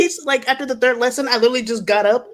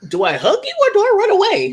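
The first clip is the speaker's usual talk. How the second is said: much higher, torn between two options; mock panicked dilemma; 11 semitones higher than usual. Joking about Southern-accented German, higher and panicky. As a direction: play it as a comic panic.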